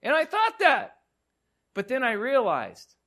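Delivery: 3 cry it out high, overcome; 1 high emotional climax. Real, not acted. 4 preacher to congregation at the peak of his story, high and overwhelmed.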